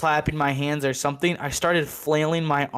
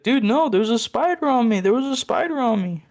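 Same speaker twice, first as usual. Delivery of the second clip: panicked; 9 semitones higher than usual. Retold or imitated. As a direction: beg them to believe you, panicking. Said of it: "It's like".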